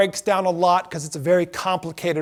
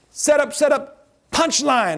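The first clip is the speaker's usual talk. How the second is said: brighter, higher joke-teller voice on setup and punchline; mock tour guide delivery; 6 semitones higher, slower than usual. Shown as a demonstration. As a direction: pitch the setup up like a hammy skipper. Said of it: "So I go like this".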